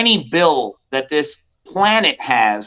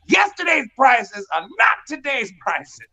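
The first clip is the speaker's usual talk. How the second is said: mock rant; 7 semitones higher than usual. Real, not acted. Ranting about changing rules.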